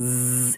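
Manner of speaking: sustained "zzz" like a bee; imitated bee drone; bee buzz. Shown as a demonstration. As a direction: buzz through the teeth like a honeybee. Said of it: "মৌমাছির আওয়াজটা কীভাবে হয়".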